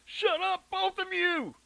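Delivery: high, loud angry yell. A narrator's reading. Mother yelling at her children from the bedroom, angry high yell. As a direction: bellow it from the next room.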